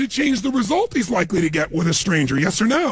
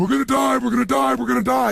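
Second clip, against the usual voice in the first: hammed-up terror, over and over; mock panic; 7 semitones higher than usual. Passengers sure the lions will eat them, mock panicked wail. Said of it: "Other people".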